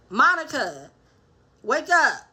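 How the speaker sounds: usual speaking voice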